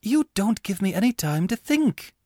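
protest, flustered and exasperated. Acted. Laura leans on the table, exasperated with Angus. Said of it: "with considerable exasperation".

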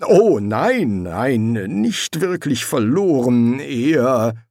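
flustered, drawn-out denial; evasive. A narrator's reading. Angel explaining away the missing sword, evasive.

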